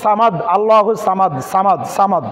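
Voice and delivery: quick, casual সামাদ with light s and open 'aa'; common thin-s mistake. Shown as a demonstration. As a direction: say সামাদ thin, unrounded first sound. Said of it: "আমাদের এই জায়গাটায় ভুল হয় কোথায়". (সামাদ-এর সা পাতলা করে)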